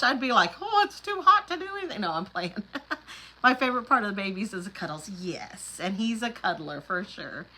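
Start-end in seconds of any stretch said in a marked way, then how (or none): 0.6-2.0 high, fast, hammed-up whine about the heat; mock heat complaint. Imagining herself in the heat, a high fast mock whine.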